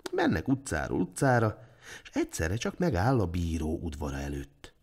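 usual speaking voice

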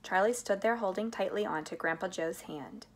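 ordinary delivery

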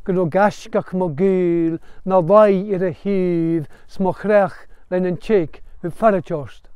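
slow recited Gaelic verse; Gaelic recitation. Shown as a demonstration. Teacher reciting Rob Roy lament line, slow Gaelic chant. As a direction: intone the line slowly in Gaelic. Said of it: "Second verse".

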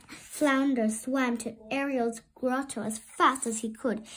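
plain reading voice

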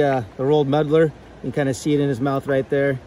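ordinary delivery

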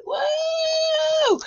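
high, bright cheer of joy; excited whoop. Real, not acted. Host cheers to viewers about her new deck, high whoop. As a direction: let out a big happy whoop.

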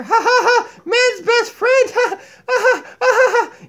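high, mocking puppet laughter; gloating laugh. Donkey gloating that dogs are superior, laughing. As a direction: laugh triumphantly at the cats.

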